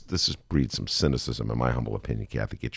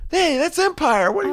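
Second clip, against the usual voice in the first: excited recognition; 5 dB louder than usual. Retold or imitated. Host acts out daughters spotting the set, excited. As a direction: blurt it out way up high, imitating excited fans. Retold reaction of daughters and friends.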